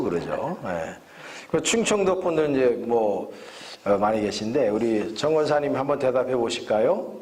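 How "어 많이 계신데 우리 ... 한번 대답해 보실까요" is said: ordinary talk, nothing marked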